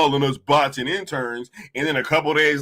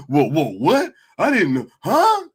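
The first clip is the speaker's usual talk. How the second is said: mock shock; 3 semitones higher, 3 dB louder than usual. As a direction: play dumb, voice climbing up in fake shock. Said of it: "all of a sudden".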